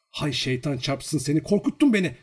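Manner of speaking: high, rising startled reproach; jumpy, high-pitched exclamation; startled scolding. Acted. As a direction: scold the one who startled you, pitch climbing. Girl startled, scolds him.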